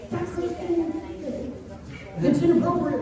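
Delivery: high-pitched mock squeak of objection; flustered protest. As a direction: play embarrassed disapproval, voice way up. Acts out his flustered protest.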